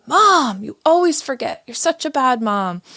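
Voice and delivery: put-on high, loud childish yelling at mom; kids yelling. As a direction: yell it like an upset kid blaming her mother. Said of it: "two of them in the back just start yelling at me".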